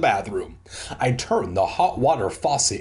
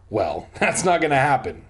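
usual speaking voice

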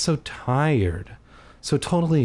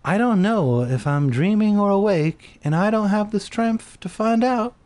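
weary; 9 semitones higher than usual. Acted. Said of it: "I told him wearily".

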